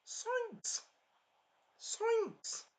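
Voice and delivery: squeaky, very high cartoon yelp; Shaggy. Looks like evil Scooby-Doo, high cartoon yelp. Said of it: "Like Shaggy would say".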